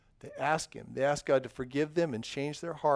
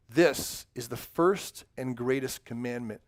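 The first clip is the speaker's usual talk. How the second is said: slow, careful Bible reading; nice and slow; 4 semitones lower, slower than usual. Shown as a demonstration. Pastor reads the verse to children, slow and deliberate. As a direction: read each word slowly to the kids. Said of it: "I'm gonna read this nice and slow".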